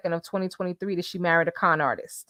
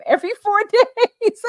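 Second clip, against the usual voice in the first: higher, cracking up mid-sentence; breaking into laughter; 6 dB louder than usual. Real, not acted. Reading Jermaine's joke comment, breaking into laughter. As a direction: lose it laughing as the punchline lands.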